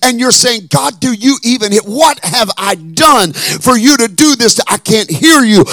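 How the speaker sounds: loud, rising preacher's cry over music; full-force preaching. Acted.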